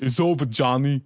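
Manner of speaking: a lightly voiced, bad Rambo accent; only a hint of a Stallone accent. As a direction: mumble it like a badly done Stallone. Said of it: "which is not a good accent at all".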